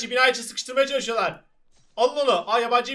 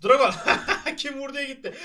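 ordinary talk, nothing marked

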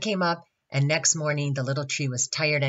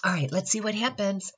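plain reading voice

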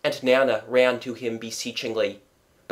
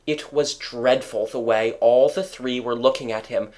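plain reading voice

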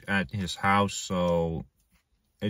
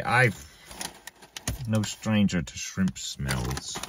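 ordinary delivery

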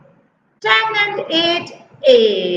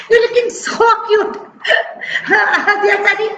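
laughing; 2 semitones higher than usual. Real, not acted. Teacher laughs at child's face.

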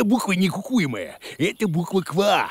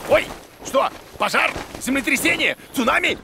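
higher, panicky guesses; mock panic; 8 semitones higher than usual. Boda panics at the letter from mum. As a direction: fret about disasters.

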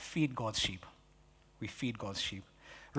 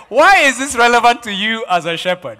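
louder, with a laugh in the voice; loud laughing question; 5 semitones higher, 11 dB louder than usual. Real, not acted. Preacher repeats question, then plainer.

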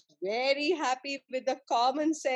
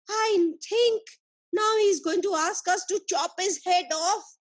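higher, rapid alarmed outburst; scandalised disbelief, voice pitched up; shocked; 6 semitones higher than usual. Acted. Soldiers aghast at how the man talks to their emperor, shocked. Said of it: "The soldiers got shocked".

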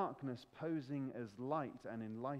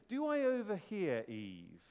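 hammed-up posh devil; posh Satan voice; 5 semitones higher, 5 dB louder than usual. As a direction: swoop down grandly like a posh tempter. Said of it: "I imagine he's got a posh voice".

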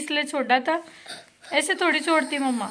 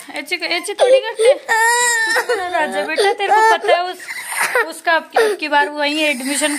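raised, higher coaxing voice; motherly pleading; 5 semitones higher, 5 dB louder than usual. Real, not acted. Mother reasons with crying son, loud pleading.

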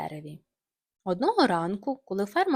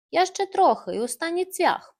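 puffing; 8 semitones higher, 5 dB louder than usual. Acted. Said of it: "пихтів Тім".

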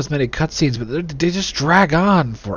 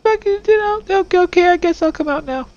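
very high monotone squeal; horrified little witness. Acted. Gamer voicing the child appalled at the murders, squeaky horror.

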